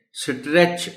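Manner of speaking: اسٹریچ with an extra vowel before the s, "is-treech"; wrong split st. Shown as a demonstration. Teacher showing the children's mistake, with a vowel wedged into اسٹریچ. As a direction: say اسٹریچ the wrong way, breaking s and t into "sitretch". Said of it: "اس طرح پروناؤنس کریں".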